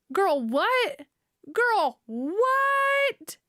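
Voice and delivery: incredulous. Real, not acted. Answering the jailed mom's excuse, high incredulous sass. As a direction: sass her excuse with disbelief.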